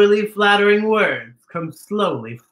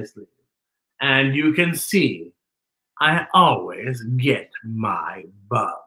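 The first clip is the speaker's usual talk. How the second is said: put-on a gloating spider reads its note to the children; sly spider letter.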